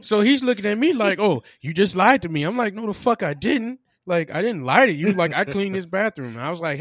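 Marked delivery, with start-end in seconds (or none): 1.2-2.4 louder, higher accusing boss; mock accusation. Manager accuses him in retold story. 2.7-6.4 loud, higher retold protest; indignant denial.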